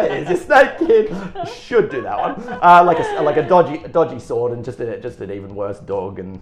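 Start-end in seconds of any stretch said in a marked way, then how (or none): 0.1-2.6 high, loud, laughing put-on kid; mocking kid voice. Bratty kid mocks his balloon snake, high and laughing. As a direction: sneer like a bratty child handing over a snake.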